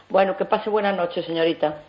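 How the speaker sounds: usual speaking voice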